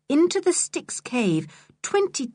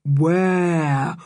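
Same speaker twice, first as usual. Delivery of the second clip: fading echoing repeats; 2 semitones lower, 3 dB louder, much slower than usual. A narrator's reading. The cave echoes answer George's call, echoing. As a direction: let it ring like a cave echo. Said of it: "echoes thundered out".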